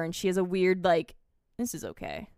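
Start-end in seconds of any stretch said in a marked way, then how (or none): 1.6-2.3 very low, hushed possessed voice; possessed woman. Retold or imitated. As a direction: imitate someone taken over, deep and quiet. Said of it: "something's taken her over".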